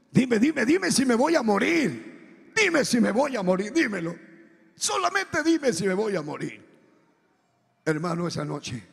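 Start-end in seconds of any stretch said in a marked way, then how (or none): 0.1-6.6 raised, insistent begging, reenacted; desperate plea. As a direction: beg God urgently for an answer.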